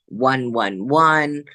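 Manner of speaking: each one drawn out slowly, x0.5; deliberate, spaced-out counting. Shown as a demonstration. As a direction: spell the numbers out one by one. Listing her angel numbers.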